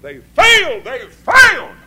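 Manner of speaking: high, loud shout on failed; shouted verdict. Acted. Preacher declaring the famous men failed, full shout.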